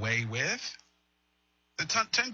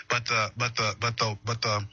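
stumbling over the same two words; real stammering; 3 semitones lower than usual. Real, not acted. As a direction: stammer and restart, hunting for the phrase. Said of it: "I'm stammering, I apologize".

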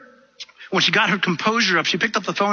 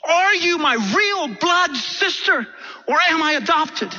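much higher, pleading demand without full volume; mock screaming; 10 semitones higher, slower than usual. Woman demanding the truth from her sister, high-pitched mock screaming. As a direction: act out desperately pressing for the truth. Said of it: "she started screaming on the phone".

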